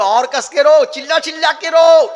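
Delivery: high, very loud egging-on; mock goading shout. Preacher acting out telling a weeping woman to cry harder, loud goading. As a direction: urge the crier on at full volume.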